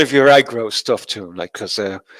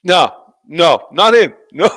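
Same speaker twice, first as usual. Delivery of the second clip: mock refusal; 5 semitones higher, 4 dB louder, slower than usual.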